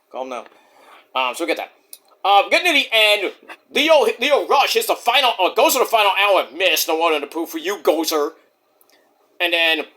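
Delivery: usual speaking voice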